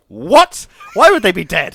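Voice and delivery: high, loud shocked shout; husband bursts out, horrified; alarmed shout. Retold or imitated. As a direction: yell it in sudden alarm. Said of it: "And he goes".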